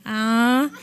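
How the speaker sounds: ordinary talk, nothing marked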